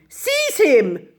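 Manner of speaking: high, loud command; crying out. A narrator's reading. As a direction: grab him now. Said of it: "they cried".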